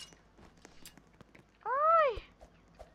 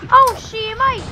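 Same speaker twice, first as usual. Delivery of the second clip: loud exclamation; 6 semitones higher, 11 dB louder, slower than usual. Real, not acted.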